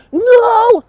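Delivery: very high, loud mock yell of no; badly acted shriek; hammed-up no. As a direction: overact a terrible screamed no. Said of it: "Like some terrible, a-, horrible acting".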